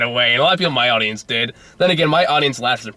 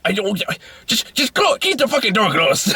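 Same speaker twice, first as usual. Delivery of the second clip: much higher, mock-fed-up command; exasperated order; 9 semitones higher than usual. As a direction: snap at him to shut the door. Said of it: "It's like".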